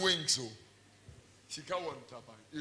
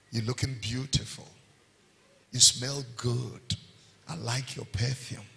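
tender compliment; 5 semitones lower, 4 dB quieter than usual. Shown as a demonstration. Husband softly complimenting his wife. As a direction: murmur it sweetly to your wife. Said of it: "Learn to say things like".

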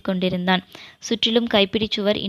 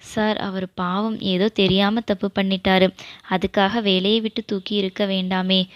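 plain reading voice

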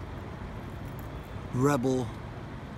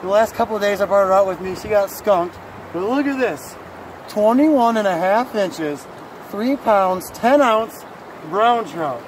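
louder, higher, rushing with pride; excited bragging; 4 semitones higher, 6 dB louder than usual. Real, not acted. Husband bragging to viewers about his wife's big trout, excited and loud. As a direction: show off the big catch, thrilled.

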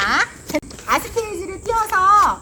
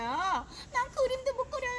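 higher, a hint of a timid kid; shy child's voice; 9 semitones higher, 12 dB quieter than usual. Teacher voicing a child who doubts their decorating, higher and timid. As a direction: speak as the kid who is unsure. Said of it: "하는 친구는요".

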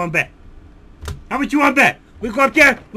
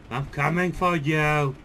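lower, softer, slow menace; menacing low threat; 8 semitones lower, 9 dB quieter, slower than usual. Acted. Steve stalks a hiding raider, low quiet menace.

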